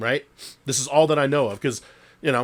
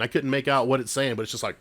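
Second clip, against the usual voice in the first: ordinary talk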